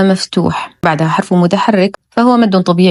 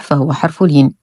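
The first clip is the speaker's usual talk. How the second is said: ordinary delivery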